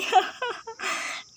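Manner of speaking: high rising giggle. Acted. Bride giggling to her mother. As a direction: break into a giggle.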